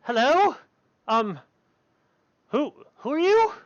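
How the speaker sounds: high, hesitant little voice; high-pitched, questioning girl at the door; young girl character. Acted. Small girl opens the door to strangers, high hesitant questioning. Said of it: "and she's like".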